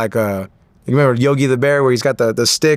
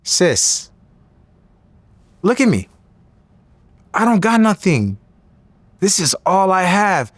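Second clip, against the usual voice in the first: higher-pitched plea with long pauses; retold earnest pleading; 5 semitones higher than usual. Young boxer begging his sister to let him go, pleading. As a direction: plead with her, piece by piece.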